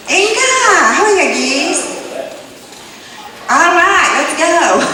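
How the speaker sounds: very high, giggly Creek woman's voice; Creek woman agreeing. Retold or imitated. Second Creek woman happily agreeing to go to Walmart, high and giggly. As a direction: squeal it up high with a laugh. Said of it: "That's how Creek women talk".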